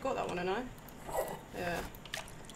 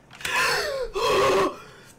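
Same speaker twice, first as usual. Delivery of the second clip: startled gasp; 12 semitones higher, 4 dB louder than usual. Real, not acted. Startled during horror game.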